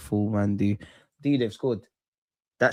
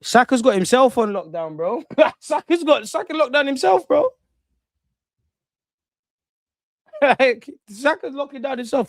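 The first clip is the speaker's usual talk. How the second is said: much higher, cracking up mid-sentence; laughing; 12 semitones higher, 4 dB louder than usual. Real, not acted. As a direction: giggle through the jibe. Laughing at Saka's poor game.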